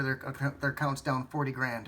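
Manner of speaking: ordinary delivery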